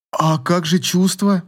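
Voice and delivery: blurted out. A narrator's reading. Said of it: "вырвалось у неё".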